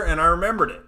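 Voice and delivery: usual speaking voice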